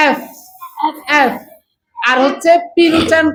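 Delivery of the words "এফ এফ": এফ ending in a clear F friction; F sound demo. Shown as a demonstration. Teacher contrasting F with P, clear F. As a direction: hold the F at the end of এফ. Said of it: "এফ এর উচ্চারণ আমরা কি করছি?".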